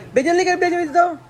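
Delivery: high, bright call across the room to Benjen. Real, not acted. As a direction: call him over from a distance. Calling Benjen over to camera.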